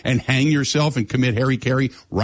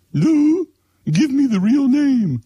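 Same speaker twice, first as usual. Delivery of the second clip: high, louder, drawn-out cook mimicry; Julia Child impression; 13 semitones higher, 4 dB louder, much slower than usual. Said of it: "That's the way she talked".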